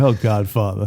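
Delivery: low, soft and pleading; whiny Johnny impression. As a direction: plead weakly like a crooner. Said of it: "he's like".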